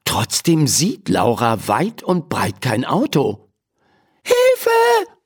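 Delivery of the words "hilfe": high, drawn call for help. Acted. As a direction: cry out for help, pitched way up. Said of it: "ruft sie erneut". (hoher Hilferuf)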